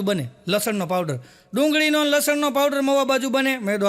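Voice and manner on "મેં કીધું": normal talking voice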